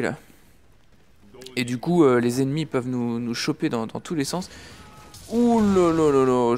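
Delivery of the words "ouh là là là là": slow, loud, drawn-out ouh là là; relieved surprise. Real, not acted. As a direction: let it spill out after a close call. Narrow escape in the game.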